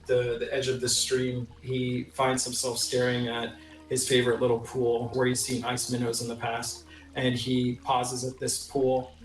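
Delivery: normal talking voice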